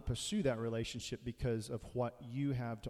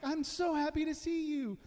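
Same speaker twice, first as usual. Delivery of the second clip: happy dog. Pretend. Dog greeting its owner at the door, very high gushing voice. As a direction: gush like an overjoyed puppy at the door. Dog back two minutes later.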